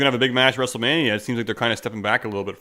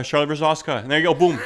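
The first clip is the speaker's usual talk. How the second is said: ordinary talk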